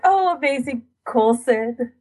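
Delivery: ordinary talk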